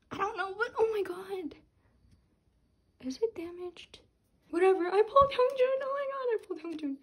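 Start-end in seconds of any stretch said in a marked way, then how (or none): 4.5-7.0 high, fast excited gush; giddy rush. Real, not acted. Fan gushing fast and high about pulling Hyungjin. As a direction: tumble the words out in joy.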